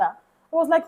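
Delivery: ordinary delivery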